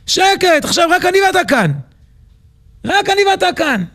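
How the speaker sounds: high-pitched, insistent silencing; menacing command. Acted. Teacher tells the bound prince they are alone, menacing.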